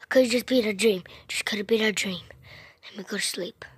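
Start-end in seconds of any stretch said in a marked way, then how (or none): 2.8-3.6 soft whispered line; sleepy pretend whisper. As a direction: whisper it as you drift off.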